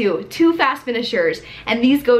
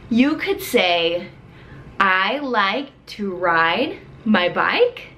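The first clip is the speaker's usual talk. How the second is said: very slow model sentence; slow teacher voice; 3 semitones lower, much slower than usual. Shown as a demonstration. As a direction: say it slowly, word by word.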